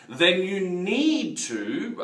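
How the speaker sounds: slow, drawn-out "need", lifted and leaned on; stress on need. Shown as a demonstration. Coach to business owners, slowly stressing "need". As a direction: lean hard on "need" and let it hang. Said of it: "and I stress the word need".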